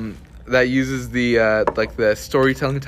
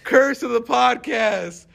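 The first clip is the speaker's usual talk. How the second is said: mock-dramatic announcement; 11 semitones higher, slower than usual.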